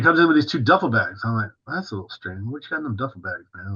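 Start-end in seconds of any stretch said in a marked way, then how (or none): 1.7-3.7 much softer, half under the breath; quiet muttered aside. Retold or imitated. Seeing Andre's duffel bags, a soft puzzled mutter.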